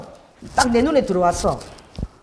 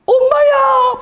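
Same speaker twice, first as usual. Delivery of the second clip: put-on very high, loud Busan-accented exclamation; Busan dialect outcry; 6 dB louder, much slower than usual. Healed owner standing up after prayer, astonished Busan dialect cry. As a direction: imitate an astonished woman crying out in Busan dialect. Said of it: "부산 사투리로".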